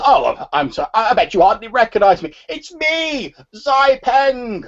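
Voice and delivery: put-on very high, loud old-man voice. Old man reintroducing himself to his friend's son, high excited old-man voice. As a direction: cry out as an old man, pitch climbing high. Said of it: "the voice of an old man".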